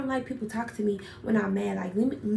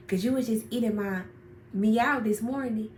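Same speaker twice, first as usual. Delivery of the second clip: ordinary talk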